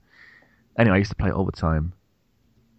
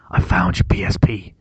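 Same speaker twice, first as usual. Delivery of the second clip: hushed secret whisper; wife on the phone reveals the found PSP, whispered; 5 dB louder than usual. Retold or imitated.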